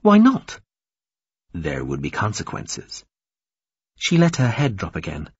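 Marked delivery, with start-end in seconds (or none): 0.0-0.6 high, loud protest; sharp indignant question; challenging. Acted. Captive challenges refusal. 1.5-3.0 low, soft formal voice; hushed, sober caution; grave refusal. Acted.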